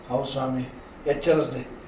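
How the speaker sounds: quiet, humble reply to Krishna; softly said. Retold or imitated. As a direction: drop the voice right down, meek. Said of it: "ಮೊದಲು ಮೆತ್ತಗೆ ಹೇಳ".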